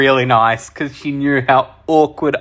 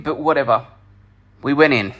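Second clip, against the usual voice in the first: normal talking voice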